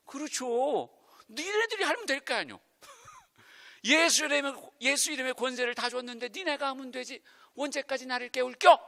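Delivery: mock-grumbling then loud command; grumpy sleepy Jesus. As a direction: complain about being woken, then command. (졸린 예수님이 투덜대는 말투)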